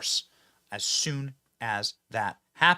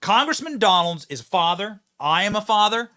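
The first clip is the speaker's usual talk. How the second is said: loud, raised orator's declaration; mock-solemn proclamation; 7 semitones higher, 6 dB louder than usual. Host defending his right to dad jokes, grandly proclaiming.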